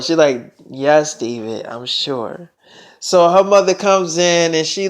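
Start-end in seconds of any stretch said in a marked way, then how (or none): 0.7-2.5 low, quiet confirmation; soft submission. Retold or imitated. Selena assures David in private, soft submission. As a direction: give in gently, voice dropped.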